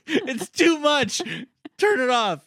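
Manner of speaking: high, slow mocking whine. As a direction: drag it out like an overwhelmed whiner. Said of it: "It's like".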